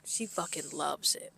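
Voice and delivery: hushed, breathy aside about the customer; mock whisper. Hosts gossiping about a late diner, in a mock whisper. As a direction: lean in and whisper it like a dirty secret.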